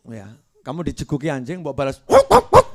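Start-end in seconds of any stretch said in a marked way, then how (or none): 1.6-2.7 high, loud barked woof; dog barking. Acted. Preacher imitating a dog, loud barking. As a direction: bark like a dog. Man barks back.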